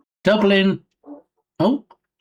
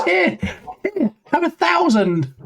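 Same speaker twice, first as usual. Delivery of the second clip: high, offhand, slowed with a laugh; casual big gifter; 12 semitones higher, slower than usual. Retold or imitated. Generous viewer tossing a thousand subs, high and casual. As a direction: toss it off like it's nothing, high.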